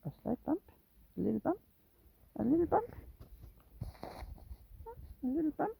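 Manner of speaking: high, soft questions with rising ends. Real, not acted. Owner asking the cat for a bump, high soft coaxing. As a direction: coax a pet in a tiny, gentle voice.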